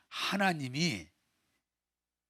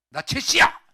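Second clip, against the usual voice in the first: high, loud stubborn outburst; shouting; 13 semitones higher, 12 dB louder than usual. Acted. Older brother declaring his stubbornness, shouting. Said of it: "이런 때 소리 질러보지 언제 질르겠어요".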